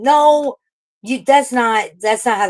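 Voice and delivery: loud, high burst of indignation; indignant outburst. Real, not acted. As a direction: cut him off hard. Angry rebuttal of Isaac.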